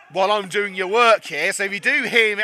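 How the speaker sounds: ordinary talk, nothing marked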